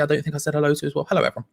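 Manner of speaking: ordinary talk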